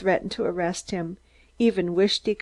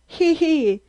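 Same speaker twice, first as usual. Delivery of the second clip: high squeaky giggle; shrill giggling squeak; 9 semitones higher, 5 dB louder than usual. Acted. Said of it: "a shrill giggling squeak".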